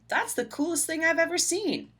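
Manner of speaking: high, delighted exclaiming; lightly voiced, amazed mother; excited mother. A narrator's reading. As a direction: gush over it like a proud mother. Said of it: "his mother exclaimed".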